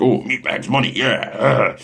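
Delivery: mock greedy marketer. To the viewers, mocking a greedy marketer, hammed-up and slow. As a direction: play a cartoonish greedy porn marketer. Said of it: "I wasn't like".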